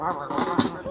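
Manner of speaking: low mock grumble; imitated grumbling. Angry bandleader still complaining to Carol, low imitated grumbling. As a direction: grumble like a disgruntled musician. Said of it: "he's still grumbling".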